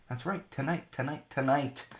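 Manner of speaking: soft, strange echoing tonight; odd echo voice. Acted. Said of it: "What was that?".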